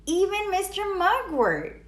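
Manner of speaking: ordinary delivery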